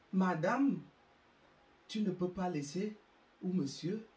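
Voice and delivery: ordinary delivery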